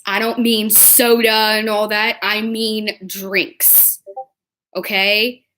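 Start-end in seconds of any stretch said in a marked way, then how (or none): 2.2-4.0 "drinks" drawn out very slowly; meaningful stress on drinks. Real, not acted. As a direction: lean knowingly on "drinks", stretching it. Insinuates alcohol, contrasted with soda.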